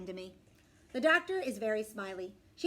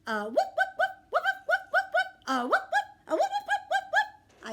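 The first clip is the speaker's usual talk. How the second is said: rapid string of high, loud barks; dog barking; 9 dB louder than usual. Acted. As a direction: bark away like an excited dog. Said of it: "I say, which means".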